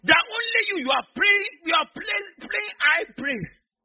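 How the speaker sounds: high, loud preaching cry; fervent shout. Real, not acted. Preacher shouting at listeners to praise high. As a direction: push it out at full force.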